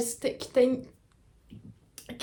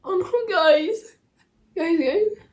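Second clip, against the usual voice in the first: high-pitched tearful wail, words drawn out; crying in dismay, voice pitched way up; real crying; 11 semitones higher, much slower than usual. Real, not acted. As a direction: wail it through real tears. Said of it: "I'm really crying".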